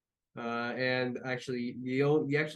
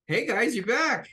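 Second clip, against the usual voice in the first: high, loud call of welcome; excited greeting; 9 semitones higher, 7 dB louder than usual. Acted. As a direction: greet returning friends from afar. Talon greets the returning party.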